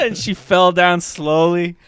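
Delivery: normal talking voice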